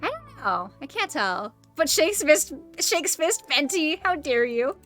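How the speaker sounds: ordinary talk, nothing marked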